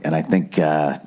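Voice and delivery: ordinary talk, nothing marked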